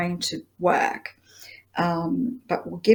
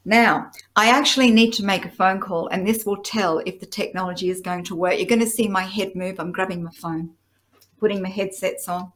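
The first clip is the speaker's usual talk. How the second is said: ordinary talk, nothing marked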